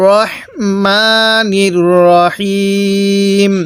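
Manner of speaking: slow drawn-out tajweed chant; Quran recitation. Shown as a demonstration. Teacher demonstrating recitation to students, slow chant. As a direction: recite slowly, stretching each vowel. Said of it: "কিভাবে পড়ব?".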